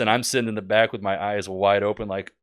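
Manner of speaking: normal talking voice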